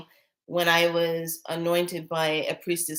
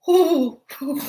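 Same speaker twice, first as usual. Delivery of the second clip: high, louder awed ooh-ooh; excited ooh; 8 semitones higher, 5 dB louder than usual. Retold or imitated. Retelling meeting the council, loud and thrilled. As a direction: let the thrill burst out. Overwhelmed by the beings.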